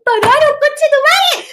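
exultant yelled pararon; triumphant shout. Retold or imitated. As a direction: burst out that they stopped. Re-living the stopping yell.

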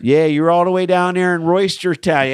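hammed-up Baltimore local; Baltimore accent. Co-host joins the Baltimore bit, in a mock Baltimore accent.

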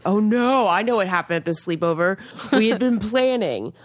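ordinary talk, nothing marked

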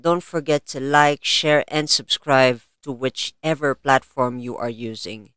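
podcast host intro. Acted. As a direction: announce it like a show host. Host opening announcement.

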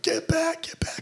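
high, sharp mock command; drill instructor order. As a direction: bark it up high like a DI. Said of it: "He's like".

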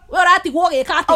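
fast, loud Kikuyu mom scolding; hammed-up Kikuyu mother, rapid and loud. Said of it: "So the mom was like".

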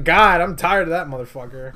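mock fed-up wife. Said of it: "She's like".

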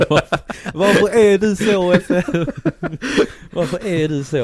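loud, high, laughing disbelief. Real, not acted. Reacting to her friend's joke, laughing and loud. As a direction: crack up while asking.

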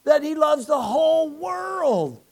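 high, loud, slow shout; shouted proclamation. Retold or imitated. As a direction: call it out the window. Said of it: "I said".

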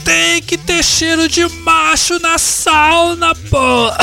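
macho rant. Acted. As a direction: rant like a tough guy.

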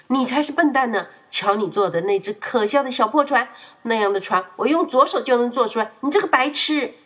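loud furious counterattack; angry retort. A narrator's reading. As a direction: shout back insults at Polly. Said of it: "艾迪开始反击说". (愤怒地反击)

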